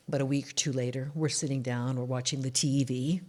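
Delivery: ordinary delivery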